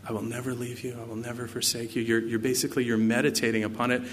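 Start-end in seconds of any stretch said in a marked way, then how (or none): 0.1-2.0 muttering to oneself. Shown as a demonstration. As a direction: demonstrate quietly pondering a verse aloud. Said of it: "It's like talking to yourself".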